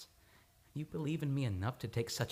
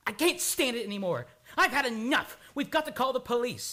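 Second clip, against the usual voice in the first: louder and higher, at the end of his rope; desperate outburst; 8 semitones higher, 8 dB louder than usual. Acted. Patient refusing the psychiatrist, desperate loud outburst. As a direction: burst out in despair. Man breaks under questioning.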